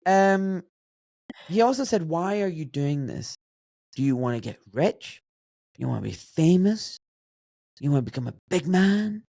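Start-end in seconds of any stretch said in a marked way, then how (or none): 2.1-9.2 blunt, probing questions with pauses between; imitated challenging dad. Dad questioning his son's reasons for overworking, bluntly challenging. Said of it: "spoke like that".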